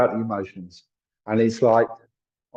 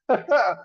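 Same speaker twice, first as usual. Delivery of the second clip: laugh; 12 semitones higher, 4 dB louder than usual. Real, not acted. Laughs at own bad geography.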